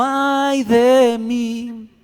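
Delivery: más alto, muy lento y estirado; lamento de Isaías. Acted. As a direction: gime con terror. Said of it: "diríamos lo mismo". (Isaías se lamenta aterrado)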